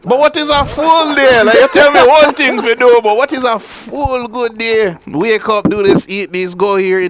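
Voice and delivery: playful, insistent Jamaican voice; mock Jamaican accent. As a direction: press the question in put-on Jamaican. Puts on patois to question the AI.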